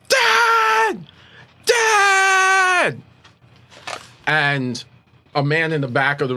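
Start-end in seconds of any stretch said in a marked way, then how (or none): 0.1-3.0 high, loud, tearful cry for her father; a panicked young girl shrieking for her father; screaming girl. Acted. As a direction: scream for dad through tears. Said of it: "in comes screaming a young girl".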